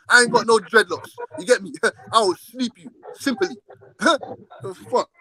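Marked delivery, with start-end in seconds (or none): none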